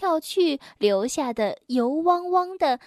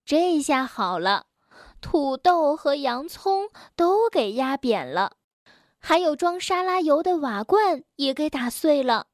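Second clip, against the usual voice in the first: usual speaking voice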